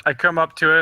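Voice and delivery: normal talking voice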